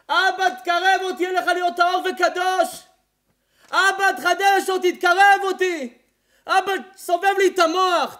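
preacher shouting the congregation's prayers; fiery preaching. Acted. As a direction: cry out the prayers to Father. Preaching at full force.

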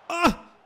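short, high, loud yelp; startled cry. Real, not acted. Player startled by the play, short cry. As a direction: yelp in sudden alarm.

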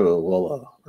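usual speaking voice

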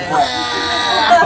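loud mock sob of a wail; mock ugly-cry wail. At a red light, retelling her ugly cry: loud mock wail. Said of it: "and I'm like".

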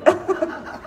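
short breathy real giggle; a quick airy laugh. Real, not acted. Reader amused by the clean shoes, short giggle.

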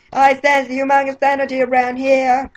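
very high cartoon character voice; high, piping anime voice; Japanese voice-actor impression. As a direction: squeak it like an anime dub. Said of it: "I'm gonna do the Japanese voice".

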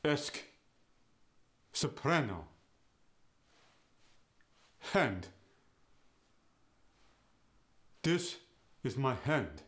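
"Esk," with an eh vowel, not "ask"; a Yiddish eh in place of the short a. Shown as a demonstration. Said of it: "go to".

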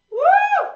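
a high, loud cheering whoop; excited whoop. Real, not acted. Instructor whooping at the class mid-set, loud and high.

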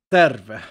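"Terve" low, loud, with rolled R; Finnish demo. Shown as a demonstration. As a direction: punch out "Terve" as a Finnish hello. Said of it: "Terve means hello".

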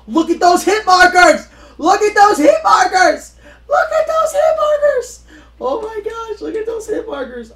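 very high, loud, gleeful shout; excited yelling. Real, not acted. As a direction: scream it with joy at the screen. Excited gamer yelling at the screen.

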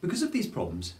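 ordinary talk, nothing marked

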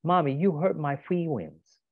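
put-on high, hurt little child; small child voice. Toddler telling his mom she hurt him, high and hurt. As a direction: speak as a wounded toddler.

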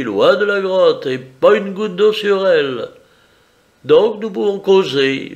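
smug and in control, lifted voice. Acted.

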